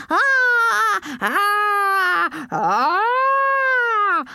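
long high drawn-out screams. A narrator's reading. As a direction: scream high, holding each cry. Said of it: "I screamed".

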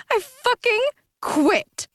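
high, slow, spat-out anger; angry spew. Acted. Aphrodite storming off, hurling it back angrily. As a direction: fling it over her shoulder. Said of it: "continued to spew anger".

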